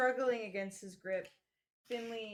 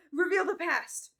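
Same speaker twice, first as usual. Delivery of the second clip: mock panic; 6 semitones higher, 7 dB louder than usual. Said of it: "like, panic as".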